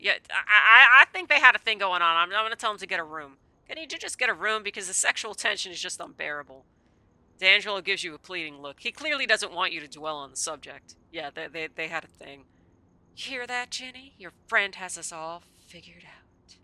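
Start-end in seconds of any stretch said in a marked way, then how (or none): 0.0-6.7 excited teasing. Real, not acted. Player teasing the NPCs loudly to get a room. 13.1-16.6 put-on Southern accent. Val teases Ginny in a light Southern accent. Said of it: "I'm making her Southern".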